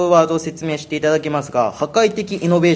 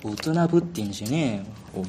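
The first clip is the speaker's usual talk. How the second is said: japanese delinquent tough talk; 7 semitones lower, 9 dB quieter than usual. Pretend. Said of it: "そのヤンキー口調に気取られてますけど".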